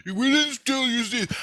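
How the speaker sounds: high-pitched imitation of denial; mock protesting scientist. Scientist denies stealing the seeds, mock protest. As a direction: squawk a defensive denial.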